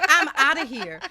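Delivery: mock teacher storming off; exasperated teacher. Teacher quitting the argument with the student, high mock huff. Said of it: "He's like".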